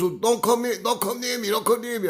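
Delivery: high, loud repeated warning to keep away; panicked neighbor. Retold or imitated. Neighbor warns the sick caller off, high and loud in fear. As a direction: yelp it up high, backing off in mock fear. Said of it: "He goes".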